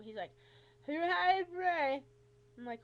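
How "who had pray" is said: loud, high, slow garbled imitation; unintelligible doctor. Patient mimicking her rheumatologist, loud and garbled. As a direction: mimic a doctor you can't understand. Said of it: "I can't hardly understand him".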